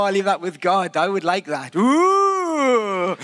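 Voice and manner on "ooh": mock impressed ooh. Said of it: "you might say".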